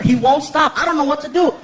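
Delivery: normal talking voice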